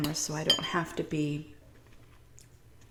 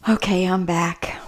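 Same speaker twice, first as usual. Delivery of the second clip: whispering; 6 dB louder than usual. Real, not acted. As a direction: back on camera in a whisper. Returns on camera with a whisper.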